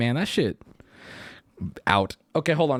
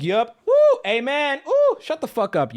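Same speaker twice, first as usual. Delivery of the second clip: high, loud mock hallelujah cheers; mock cheering crowd; 9 semitones higher, 5 dB louder than usual. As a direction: ham up the church crowd cheering. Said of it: "religious people in the audience be like".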